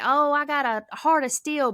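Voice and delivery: high, slow mock bravado; mock tough boast. Mocking people who claim a heart of steel, tough bravado. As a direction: puff up like a tough guy, mocking it. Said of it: "some people be like".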